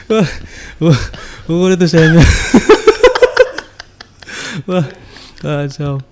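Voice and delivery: laughing through the words, loud; can't get the words out for laughing. Real, not acted. Telling the host the crude part, laughing.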